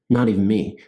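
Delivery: usual speaking voice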